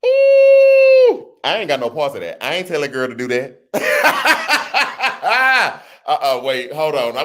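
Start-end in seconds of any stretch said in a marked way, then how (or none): 0.1-1.3 very high, loud shriek of laughter. Real, not acted. Shocked by the daughter's words. 3.7-5.8 high rolling laugh; giggling laugh. Real, not acted. Laughing at the daughter.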